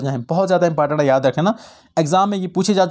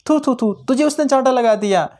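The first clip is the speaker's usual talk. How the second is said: higher, hammed-up disgust ending in a raised cry; mock disgust; 4 semitones higher than usual. Shown as a demonstration. Teacher showing students a disgusted reaction, mock disgust. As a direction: spit out the छी छी and थू थू थू in demo contempt. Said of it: "नफ़रत के मौके पे बोले जाए".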